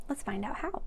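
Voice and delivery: ordinary delivery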